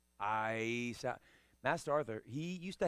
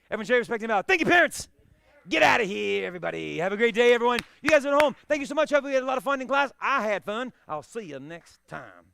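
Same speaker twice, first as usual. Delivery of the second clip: loud, high ceremonial drill calls; class commands; 9 semitones higher, 14 dB louder, faster than usual. Real, not acted. Instructor leading students to bow out of class. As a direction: call the bow like a dojo instructor.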